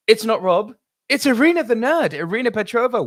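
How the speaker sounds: name announced high and loud; hyped name call. Acted.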